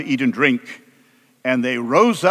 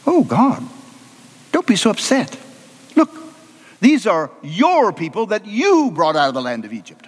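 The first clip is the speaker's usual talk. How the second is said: coaxing, with YOUR and YOU leaned on; placating Moses; 5 semitones higher than usual. Acted. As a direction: plead calmly, then throw the pronouns back. Moses soothes, pushes back at God.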